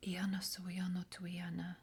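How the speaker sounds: soft, secret ritual whisper; hushed incantation; whispered invocation. Acted. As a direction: breathe the names like a spell.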